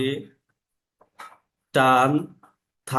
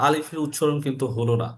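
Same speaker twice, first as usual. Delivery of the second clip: usual speaking voice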